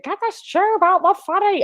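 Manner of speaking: high mock scream; retold scream. Mild mock yell at night on the children's ward. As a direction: squeal it like a morphine-addled patient.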